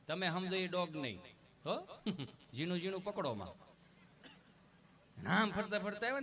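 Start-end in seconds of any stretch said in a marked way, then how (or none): none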